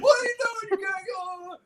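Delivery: very high mock yell; angry dad shout. Dad scolds the boy for scaring buyers, high mock shout. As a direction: blast it at the kid, outraged. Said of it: "He's like".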